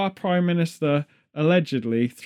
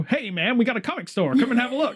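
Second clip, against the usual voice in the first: higher-pitched mock American shopkeeper; laid-back American; 7 semitones higher than usual. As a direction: play an American inviting customers in. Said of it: "America's kinda like".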